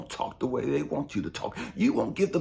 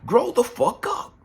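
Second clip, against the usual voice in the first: forceful; 9 semitones higher, 5 dB louder than usual. Real, not acted. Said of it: "It's not cursing you out".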